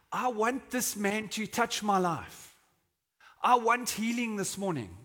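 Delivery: high, insistent, emphatic demand; passionate plea. Acted. Blind beggar determined to be healed by Jesus, passionate. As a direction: declare it with mounting passion. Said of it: "the passion inside him is like".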